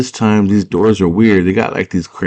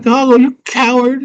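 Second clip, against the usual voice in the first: scolding Sarah. Retold or imitated. Sarah calls the runaway Hoggle a coward, high scold. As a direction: fling the insult in a high voice.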